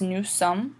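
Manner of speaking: "nous sommes" ending on the M sound; silent final S. Shown as a demonstration. Teacher warning about the final letter, "nous sommes" with silent S. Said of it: "Don't pronounce the S at the end".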